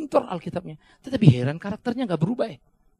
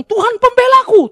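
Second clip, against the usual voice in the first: mock boast; 12 dB louder than usual. Mocking wrongdoer's claim.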